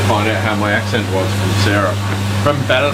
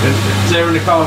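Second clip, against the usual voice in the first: mock Irish accent; 5 semitones higher, 3 dB louder, much faster than usual. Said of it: "how was my accent?".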